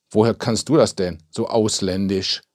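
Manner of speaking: plain reading voice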